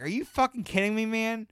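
fed-up cry at the empty kits; angry disbelief. Retold or imitated. Fed up with empty first aid kits, angry disbelief. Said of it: "I'm getting angry".

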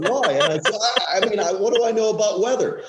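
giggling while retelling the exchange; laughing. Retold or imitated. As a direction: laugh through the quote.

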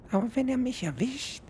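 tiny high-pitched beetle calling out; small insect voice, called up the ship. Acted. Beetle afraid.

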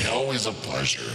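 demonic voice. Pretend. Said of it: "demon vampire party boy".

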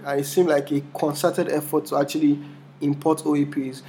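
normal talking voice

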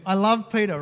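normal talking voice

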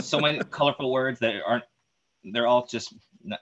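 usual speaking voice

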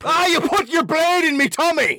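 high-pitched, hammed-up Scottish threat; mock Scottish accent. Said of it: "Is that Scottish?".

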